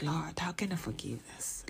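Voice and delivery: retold hushed appeal to the Lord; quietly overwhelmed, understated; emotional. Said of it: "I started to be emotional".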